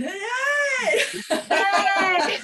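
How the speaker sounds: loud "Hey!" bursting into laughter; laughing shout of "Hey!"; big open laugh. Real, not acted. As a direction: throw the hey out wide and laugh. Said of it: "nice, big, open laughter".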